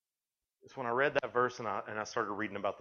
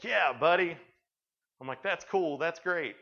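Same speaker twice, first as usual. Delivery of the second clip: mock cheer; 7 semitones higher, 5 dB louder, slower than usual. Uncle cheering nephew in pool.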